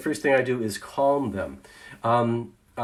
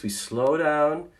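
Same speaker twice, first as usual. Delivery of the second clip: ordinary talk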